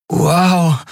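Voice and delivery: high-pitched wow of amazement; amazed. A narrator's reading. Miko blinks away the afterimages, amazed. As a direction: let out a dazzled exclamation.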